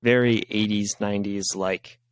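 ordinary talk, nothing marked

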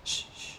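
soft shushing sound; quiet, breath-only shh, retold; hushing. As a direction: hush a partner mid-act. Acting out hushing her partner.

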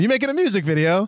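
put-on high, quick, botched accent that lands near Italian; Italian-sounding accent. Co-host retrying the father's line, accidentally Italian-sounding accent. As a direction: badly mimic a movie dad in an accidentally Italian accent. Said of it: "It's like Italian".

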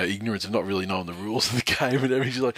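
ordinary talk, nothing marked

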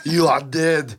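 mock-menacing Austrian impression; action-hero threat with a thick accent; Arnold. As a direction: threaten like a cartoonish Terminator. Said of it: "podcast where Arnold is".

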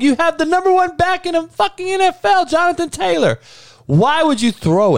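pleading with a friend over a bad take; exasperated disbelief. Real, not acted.

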